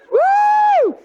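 very high, loud celebratory woo; joyful whoop. Acted. Preacher cheering his new birth, loud whoop. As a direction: let out a wild happy whoop.

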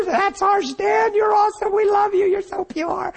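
high-pitched tearful wail; mock bawling. Man reenacting his sobbed prayer in the men's room, bawling. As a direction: cry the words out far above speaking pitch.

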